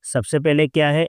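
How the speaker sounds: ordinary talk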